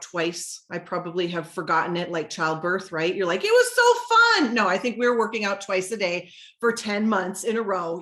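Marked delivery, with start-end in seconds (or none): 3.4-4.5 mock gushing. Mocking people who forget the hard part, high mock gushing. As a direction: gush in a put-on high voice. Mock fond memory of hardship.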